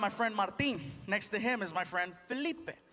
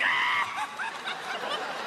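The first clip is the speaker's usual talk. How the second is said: dying laughing. Retold or imitated. Cop cracking up at the friend, high squealing laugh. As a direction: imitate helpless, shrieking laughter. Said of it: "The cop starts dying".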